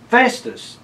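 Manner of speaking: cat calling his friend loudly, high. A narrator's reading. Cat calling Festus to come out. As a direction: call his name up high. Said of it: "the cat called".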